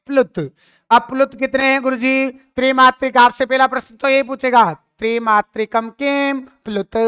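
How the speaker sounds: normal talking voice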